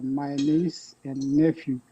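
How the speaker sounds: normal talking voice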